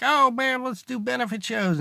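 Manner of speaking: high, eager let's-do-it; a burst of keen enthusiasm. Retold or imitated. Friends rallying behind a sick bandmate, high and eager.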